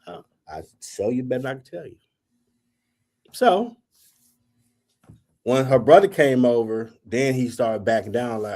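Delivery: ordinary talk, nothing marked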